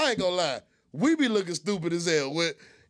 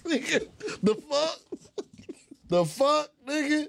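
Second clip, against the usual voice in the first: higher, incredulous laughing outburst; mock outrage; 10 semitones higher, slower than usual. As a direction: play shocked disbelief with a laugh.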